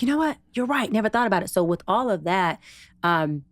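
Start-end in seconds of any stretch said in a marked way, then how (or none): 0.0-1.5 high, struck "you know what?"; sudden realization. Retold or imitated. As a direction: hit by the insight, conceding. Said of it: "And I'm like".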